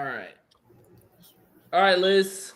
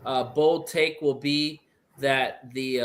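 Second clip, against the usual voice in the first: normal talking voice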